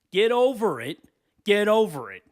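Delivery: forceful repeat; loud. Real, not acted. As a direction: hammer it home loudly. Ranting at character.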